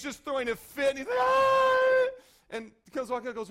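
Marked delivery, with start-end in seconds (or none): 1.2-2.1 put-on very high, loud, anguished kid's cry; child's wailing scream. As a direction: let out a tantrum wail like a crying boy. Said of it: "and he's crying".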